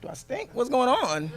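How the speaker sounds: high, loud puzzled voice; quoted father. Retold or imitated. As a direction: play the confused newcomer. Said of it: "So he was like".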